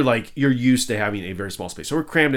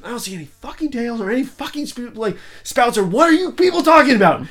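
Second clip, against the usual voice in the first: high, fed-up rant getting louder; exasperated outburst; 13 semitones higher, 7 dB louder than usual. Retold or imitated. [name] ranting at the crew about whales, high exasperated rant. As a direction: vent at them, building up. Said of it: "finally I'm like".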